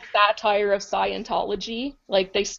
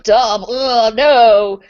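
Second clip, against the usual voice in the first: louder mock groan of disgust at dubs; mock disgusted anime fan; 2 semitones higher, 6 dB louder, much slower than usual. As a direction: sneer it like a snobby fan who hates dubs. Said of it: "It's like".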